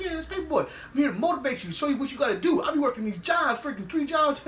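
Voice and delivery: high-pitched mock pep talk; acted fast-talking dad, voice pushed up; hammed-up motivator. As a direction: raise the pitch and sell it to the kid. Voices Bibby's motivational bragging.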